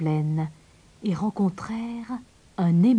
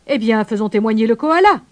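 loud pelican judge's call; raised, declaiming voice; 3 semitones higher, 7 dB louder than usual. A narrator's reading. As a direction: call the next witness out loudly. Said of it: "s'écria le pélican".

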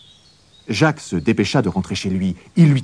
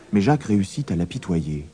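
plain reading voice

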